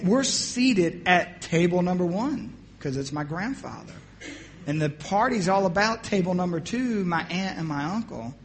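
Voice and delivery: usual speaking voice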